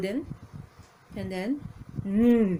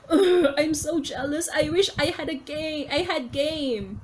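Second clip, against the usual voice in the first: mock crying; 7 semitones higher, slower than usual. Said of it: "he's crying".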